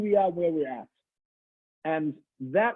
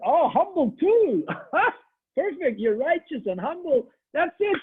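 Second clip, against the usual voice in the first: much higher, gleeful mock praise; mock delight; 12 semitones higher, 4 dB louder than usual. Rabbi toying with the scared preacher, gleeful high mock praise. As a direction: gush over him like a delighted fake fan.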